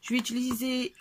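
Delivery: ordinary talk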